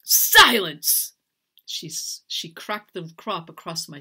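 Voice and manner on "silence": loud, high angry order; imperious raised-voice command; furious command. A narrator's reading. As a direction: cut them off with a shout. Mistress cuts off servant's plea.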